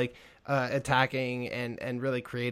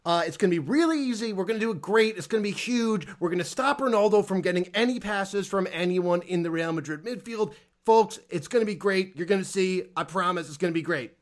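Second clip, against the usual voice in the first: mock politician's sales pitch, pitched up; Donald Trump; 4 semitones higher than usual. Promising folks Ronaldo will be stopped, hammed-up politician impression. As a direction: play a boastful politician promising it will be easy. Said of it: "in the fucking Donald Trump voice".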